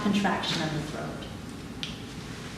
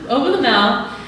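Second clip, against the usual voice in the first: laughing; 5 semitones higher, 4 dB louder than usual. Real, not acted. Still laughing, resuming the instructions.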